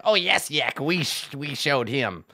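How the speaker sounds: lightly voiced eager goblin lackeys; goblin minions. Goblins agree with their boss Yek, fawning. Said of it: "the goblins are like".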